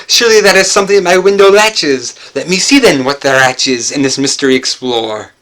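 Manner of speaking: loud and fast, pressing on; loud hurried alarm; urgent loud. A narrator's reading. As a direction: rush the words, heart pounding. Narrator rushing to the window.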